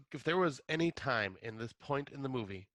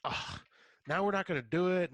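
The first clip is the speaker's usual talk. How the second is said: higher, louder mock complaint; cocky; 8 semitones higher, 4 dB louder than usual. Matt griping about the drugs, cocky and put out. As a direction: imitate an annoyed, cocky friend griping. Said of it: "Matt was just getting all cocky".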